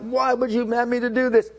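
살짝 흉내 낸 울부짖음; mock lament. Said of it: "and he's crying out".

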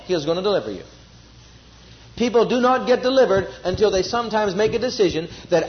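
normal talking voice

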